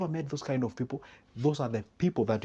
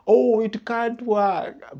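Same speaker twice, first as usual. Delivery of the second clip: much higher, louder, very slow drawn-out doom; mock dismissal; 10 semitones higher, 7 dB louder, much slower than usual. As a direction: stretch out the oh in disbelief. Negative friend dismisses saving.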